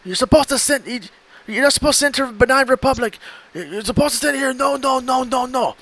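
put-on high, shouting Nigerian-accented no no no; exasperated Nigerian scammer yelling. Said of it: "talks to this Nigerian guy".

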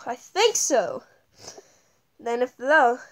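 ordinary talk

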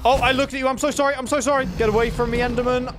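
high, hurried pleading; flustered, high-pitched panic; panicked apology. Real, not acted. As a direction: beg forgiveness in sudden alarm. Accidentally provoked an enderman.

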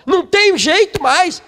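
hammed-up hopeless cry; despairing believer. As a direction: cry it out like someone with no hope. Mimics despair, preaching.

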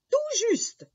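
very high, loud exclamation; delighted confirmation. Acted. Old man confirms the boy guessed right, high delighted cry.

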